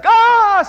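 sudden high, loud yelp of pain; child's pain scream. Retold or imitated. Child touches hot stove.

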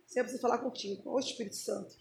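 low, soft plea to God; quiet, reverent request; hushed prayer. Acted. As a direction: speak softly, as if privately asking God. Acting out a private prayer.

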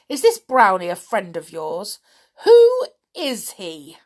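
witch barking questions at Binky; loud, furious witch voice; angry witch. A narrator's reading. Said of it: "said the angry wi".

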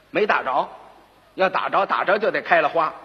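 plain reading voice

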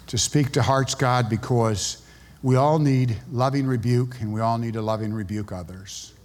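fading pastoral prayer; closing prayer. Acted. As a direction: pray humbly as the sermon closes.

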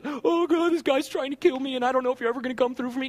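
hurried, hammed-up moan to God; mock despairing prayer. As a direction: run it together like a helpless complainer. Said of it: "Did he talk like that?".

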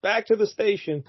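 high, fast, breezy mock cop; hammed-up high offhand cop; careless cop. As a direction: shrug it off like a lazy cop. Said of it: "he's like".

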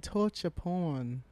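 put-on very slow, drawn-out British accent. Mocking the guest speaker's pronunciation, slow British accent. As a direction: stretch it out in mock British. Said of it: "Is that how you say it?".

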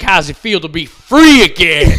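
high, drawn-out announcer shout; imitated jeering announcer, very loud; mock announcer. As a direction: bellow it like an emcee working a crowd. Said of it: "like, an announcer".

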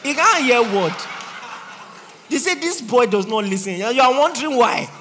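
loud, laughing scold; laughing loud rebuke; mocking shout. Real, not acted.

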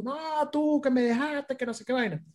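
high, soft mock wail; retold drunk woman's complaint. Retold drunk woman's angry reply. (queja aguda de borracha, imitada)

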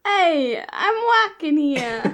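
loud New York street yell; bad Brooklyn cabbie voice; Brooklyn accent. Classic Brooklyn catchphrase after accent talk.

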